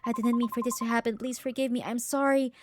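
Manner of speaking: ordinary talk, nothing marked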